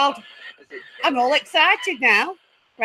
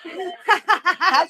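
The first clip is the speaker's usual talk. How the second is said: delighted high giggle; 5 semitones higher than usual. Real, not acted. Spirit box laughed at her.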